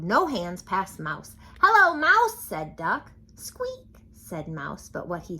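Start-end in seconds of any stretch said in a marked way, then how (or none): 1.6-2.4 much higher, louder duck greeting; cheery duck. A narrator's reading. Duck riding past the farm animals, high cheery greeting. 3.4-3.9 very high, soft squeak; mouse squeak. A narrator's reading. Mouse answering Duck, tiny squeak.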